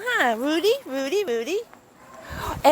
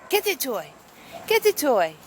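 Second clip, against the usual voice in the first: normal talking voice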